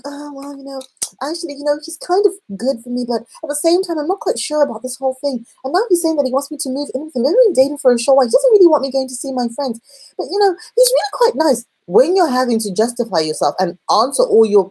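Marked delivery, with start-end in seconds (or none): 0.0-11.6 higher-pitched, hesitant excuse-making; mock unsure girlfriend, pitched up and speeding up; wavering girlfriend. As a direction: make excuses for him in a higher voice.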